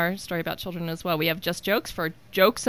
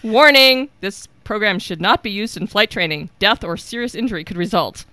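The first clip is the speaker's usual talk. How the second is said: mock warning announcement; 2 semitones higher, 7 dB louder than usual. Reading a warning label.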